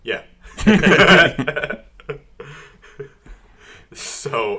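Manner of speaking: ordinary talk, nothing marked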